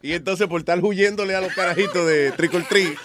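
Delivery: laughing through the words; giggling at own mistake; laughing while telling. Real, not acted. Laughing at own silly story.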